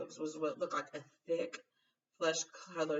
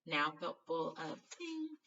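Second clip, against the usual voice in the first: usual speaking voice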